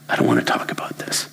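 fast, soft whispered brush-off; cold whisper. Acted. Wife refuses to talk to husband, whispered. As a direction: shut him out under your breath. Disgusted wife shuts him down.

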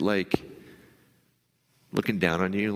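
ordinary talk

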